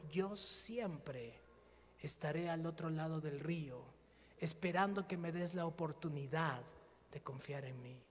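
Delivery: gentle, low divine voice. Retold or imitated. God speaking tenderly.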